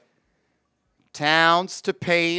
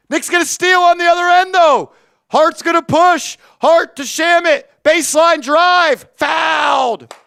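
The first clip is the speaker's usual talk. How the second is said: raised, pushing game call with rising energy; higher excited commentary; live play-by-play; 5 semitones higher, 4 dB louder than usual. Acted. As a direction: ride the fast break with the announcer voice. Calling a fast break live.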